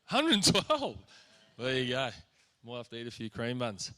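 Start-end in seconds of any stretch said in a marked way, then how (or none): 0.1-0.9 high, loud astonished repeat; can't believe the number; surprised echo. Real, not acted. Echoing surprising lift record.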